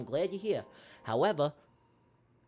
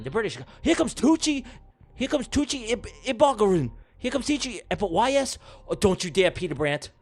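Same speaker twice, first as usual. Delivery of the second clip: race play-by-play; 4 dB louder than usual. Pretend. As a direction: call a duck race like a live sportscaster, climbing as the winner appears. Live duck race play-by-play.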